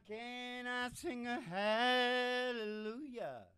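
slow, drawn-out revival preacher; hammed-up preacher drawl; preacher call. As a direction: stretch it out like a tent-revival preacher. Preacher calling to congregation.

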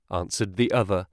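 plain reading voice

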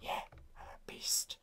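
whispered cocky boast; mock the boaster, whispering; whispered mock brag. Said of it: "and he's like".

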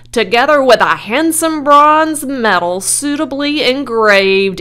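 loud, high, sustained ceremonial address; loudspeaker announcer. A narrator's reading. Loudspeaker awards a special prize, booming formal announcer. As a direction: project it over a fairground crowd. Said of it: "continued the loudspeaker".

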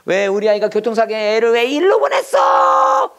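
mock bawling that builds into a long high cry; hammed-up wailing. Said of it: "하면서 우는 거야".

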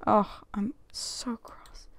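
slow annoyed whisper; hushed, slow grumble. Real, not acted. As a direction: hiss it quietly, slowly. Partner missed the ultrasound.

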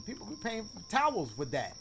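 normal talking voice

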